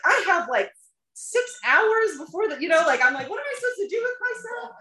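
high-pitched, comically panicked protest; mock panic. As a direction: replay your panic, pitch climbing sharply. Acts out panic at being left.

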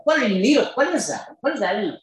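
high-pitched, pressing mock interrogation; retold pestering questions, pitched high; insistent questioning. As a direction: press for an answer, pitch jumping up. Mock grilling of embarrassed seatmate.

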